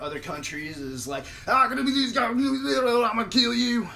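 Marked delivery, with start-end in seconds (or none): 1.5-3.9 high, loud incomprehensible hog babble; garbled Southern talk. Acted. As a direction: gibber like the talking hog, ending in a threat. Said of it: "that's what we sound like to".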